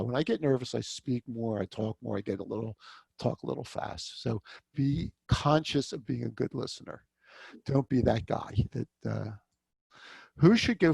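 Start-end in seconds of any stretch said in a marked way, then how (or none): none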